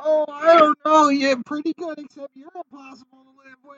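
high falsetto lady voice; man doing a woman's voice. Pretend. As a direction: squeak it up like a woman. Husband voicing wife.

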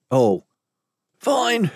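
high, frightened surrender; quivering. Acted. Captive giving in to his captors, quivering. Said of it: "Terran quivered".

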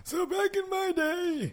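put-on slow, high creaky old-timer voice; little grandpa. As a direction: play a rambling old grandpa. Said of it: "you are a little grandpa with the stories".

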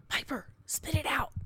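imitated impatient whisper; retold husband's hushed exasperation; whisper yell. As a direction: hurry a child along in an urgent whisper. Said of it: "whisper yell".

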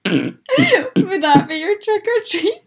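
talking through big laughter; words broken by laughs; laughing. Real, not acted. Laughing at the seal noise.

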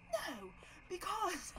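high tearful whine, imitated; mock a man crying as he argues; upset teary guy. Imitating the upset neighbor arguing with friends, high whine. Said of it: "he's talking like this".

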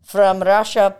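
put-on slow, loud hammy Russian accent; drawn-out Russian accent ad slogan. Mocking Russian realtor ad.

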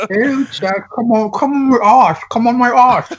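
mock the toothless English lady's British come-on; mimicking the toothless British lady in a British accent. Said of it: "What's a British accent sound like with no teeth?".

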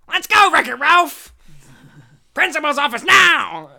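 high, very loud strained yell; Paul Giamatti. Shown as a demonstration. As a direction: bellow it as a hammed-up impression. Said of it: "No, that's my Paul Giamatti".

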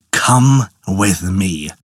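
low, slow, stern command; stern turtle. A narrator's reading. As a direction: order her along, stern and slow. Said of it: "he said sternly".

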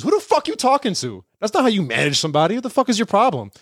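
high, heated tirade; outraged sister. Retold or imitated. Sister grabs the phone and tears into the boss, high and heated. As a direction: chew out the boss, voice way up. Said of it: "Snatched the phone and goes".

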